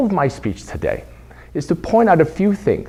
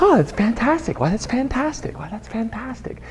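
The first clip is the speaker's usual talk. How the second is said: put-on high, bouncy child voice, repeating her new word; excited little girl; 7 semitones higher, 6 dB quieter than usual. Niece repeating her new word, high and excited. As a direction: play the kid delighted with a new phrase. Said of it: "Vivi Jean's like".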